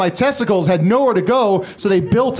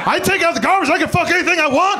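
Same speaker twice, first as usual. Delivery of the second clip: high, hammed-up creep boasting; high, hammed-up creep, rushing the last line; mock creep voice; 10 semitones higher, faster than usual. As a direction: parody his own creepy reasoning. Mocking his own creepy logic.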